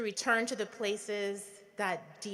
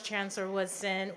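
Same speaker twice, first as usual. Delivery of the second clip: ordinary talk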